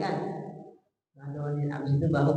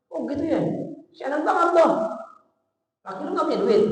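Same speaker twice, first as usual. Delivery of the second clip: higher, louder sneering tone; mocking scorn; 9 semitones higher, 3 dB louder, faster than usual. Shown as a demonstration. Father mocking daughter's husband for having no money, sneering. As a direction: sneer at the son-in-law's empty pockets. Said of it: "Jangan malas-malasin".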